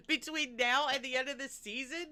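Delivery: usual speaking voice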